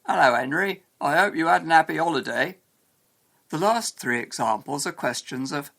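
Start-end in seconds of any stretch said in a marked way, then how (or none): 0.1-2.6 louder common British accent, H's dropped; common English accent. Shown as a demonstration. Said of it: "speaking with a more common accent".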